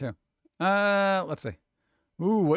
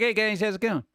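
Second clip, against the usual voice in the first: lightly voiced happy cartoon greeting, a bit higher; cheery food character; 5 semitones higher than usual. Voicing the happy character on the food, a light chirpy greeting. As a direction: give the smiling snack a chirpy little hello. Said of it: "He's like".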